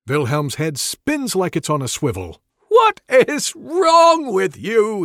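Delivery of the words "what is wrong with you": high, loud, slowed yell. Acted. Wizard spinning round at the rogue, horrified yell. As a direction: shout it in horrified disgust. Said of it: "he yells".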